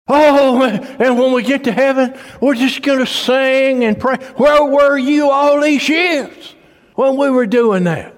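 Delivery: high, loud preaching climax; preaching at full force. Acted. Preacher scolding the congregation, loud and high. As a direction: build the sermon to a fever pitch.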